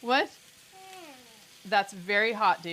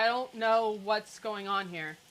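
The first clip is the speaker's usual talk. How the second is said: normal talking voice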